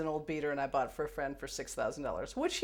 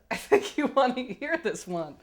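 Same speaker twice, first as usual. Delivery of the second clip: quoting assistant; 9 semitones higher, 6 dB louder than usual. Retold or imitated. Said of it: "And he said".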